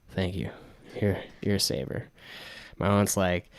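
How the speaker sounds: normal talking voice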